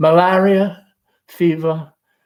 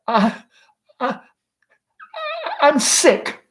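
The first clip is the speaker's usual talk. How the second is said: panicked stammer; 13 semitones higher, slower than usual. Acted. Laura panicked excuse.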